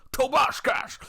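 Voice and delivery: high, loud name shout; excited call. Retold or imitated. Remembering old YouTube, shouting the name in excitement.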